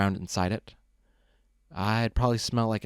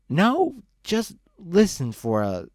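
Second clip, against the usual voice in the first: put-on high, pleading drunk voice, much higher; drunk begging; 9 semitones higher, 4 dB louder, slower than usual. Drunk Luce begging Brick to listen, high pleading slur. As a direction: beg him to just listen, slurring. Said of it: "Luce begged me".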